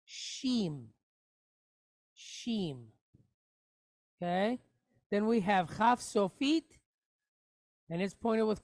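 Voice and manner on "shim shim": Shim said softly, sh-eem with a closed m; quiet, careful Shim; model syllable. Shown as a demonstration. Teacher models Shim softly for students to copy. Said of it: "pronounced how".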